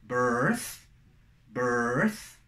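"birth" rising, with final th; list drill. Shown as a demonstration. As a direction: lift each "birth", ending on th. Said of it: "Entonces tenemos". ("birth" ascendente, con th final)

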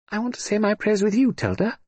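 put-on child begging to say prayers with aunt; pleading little girl. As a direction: coax her great-aunt sweetly.

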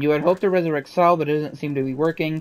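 story narration. Acted. Reads the game narration aloud.

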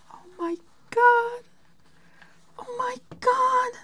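a high, breathless oh my God; high breathless gasp. Real, not acted. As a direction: gasp it, overwhelmed with joy. Overwhelmed by gift.

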